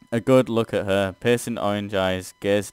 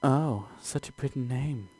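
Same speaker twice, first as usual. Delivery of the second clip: higher, softer cooing demoness; 4 semitones higher, 6 dB quieter than usual. Acted. Omnibus cooing at the adventurer's name, in a higher soft coo. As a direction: coo it sweetly. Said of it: "The omnibus coos".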